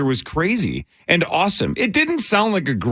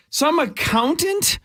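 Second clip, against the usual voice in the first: disbelief; 9 semitones higher than usual. Retold or imitated. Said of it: "and you're like".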